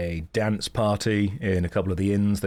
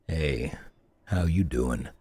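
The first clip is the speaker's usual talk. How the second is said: much lower, groggy greeting; deep morning voice; 5 semitones lower than usual. Acted. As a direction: mumble a low half-awake hello.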